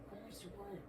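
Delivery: very soft and quick, fading to a whisper; fading hushed whisper; faint whisper. Real, not acted. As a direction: whisper the question to the spirit.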